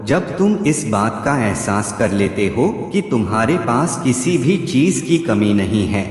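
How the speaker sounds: normal talking voice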